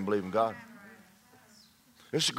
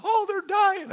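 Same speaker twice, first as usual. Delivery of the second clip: very high, hammed-up wail; comic high-pitched panic; mock alarm; slower than usual. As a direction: squeal it like a soap opera melodrama. Acts out soap opera alarm.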